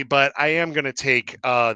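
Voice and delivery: ordinary talk, nothing marked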